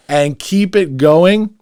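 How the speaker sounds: rally the listeners as the show ends; loud sign-off. Real, not acted. Host closing the episode to fans, loud hyped sign-off. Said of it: "I'm gonna get loud like I always do".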